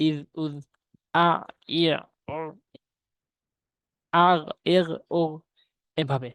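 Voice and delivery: each Arabic syllable আদ, ইদ, উদ clipped short on its final consonant; crisp teacher drill of আফ, ইফ, উফ with the closing letter stopped dead; sukun syllable drill. Shown as a demonstration. Teacher reads sukun syllables to students, clearly stopping each one. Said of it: "আমি reading বলছি, একটু মনোযোগ সহকারে শুনবেন".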